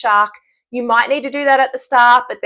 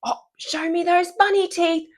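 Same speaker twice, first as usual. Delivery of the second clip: put-on light high coaxing cue for a child; 9 semitones higher, 4 dB quieter than usual. Shown as a demonstration. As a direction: coax the kid to show bunny teeth, higher. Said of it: "So when I say".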